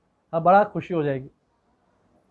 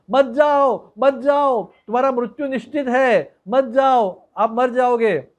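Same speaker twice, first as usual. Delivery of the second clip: raised, higher-pitched cries of warning; crowd shouting warning; 5 semitones higher, slower than usual. Acted. Villagers warn the saint away from the granary, shouting. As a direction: call out urgently to stop him. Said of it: "लोग चिल्लाने लग गए".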